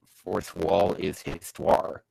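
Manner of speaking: usual speaking voice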